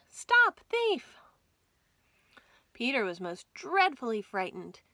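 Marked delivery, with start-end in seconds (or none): 0.1-1.1 much higher, much louder shout of stop thief; calling out. Acted. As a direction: yell after the runaway rabbit. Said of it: "calling out".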